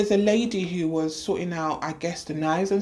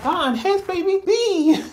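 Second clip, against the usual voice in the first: much higher, louder laughing reveal; cheerful announcement; 13 semitones higher, 5 dB louder than usual. Retold or imitated. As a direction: present the second baby with a laugh. Staff presenting the second twin.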